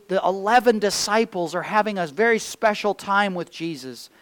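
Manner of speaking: ordinary talk, nothing marked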